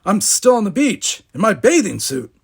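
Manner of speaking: loud, high admission of dismay; alarmed. A narrator's reading. Will on the beach tells Geordi, alarmed. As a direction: blurt it out in alarm. Will panics at being late.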